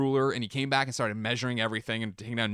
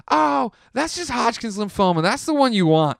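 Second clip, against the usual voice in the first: put-on higher, slower drunk woman's voice; breezy tipsy girl tossing out a diagnosis; drunk stranger; 11 semitones higher, 5 dB louder than usual. Drunk girlfriend's careless remark.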